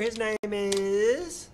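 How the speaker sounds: stretched slow, rising at the end; drawn-out intro. Real, not acted. Host introducing the next artist, drawn-out intro. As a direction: hang on the last word as if awaiting the reveal.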